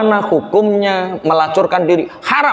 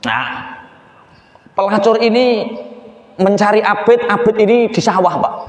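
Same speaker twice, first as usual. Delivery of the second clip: usual speaking voice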